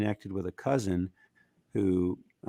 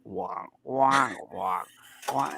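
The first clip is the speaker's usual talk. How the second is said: imitated guitar wah; 5 semitones higher, much slower than usual. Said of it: "it was like".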